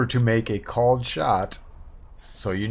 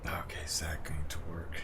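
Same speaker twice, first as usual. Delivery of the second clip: whisper; 7 semitones lower, 12 dB quieter than usual. Real, not acted. Whispered aside while checking.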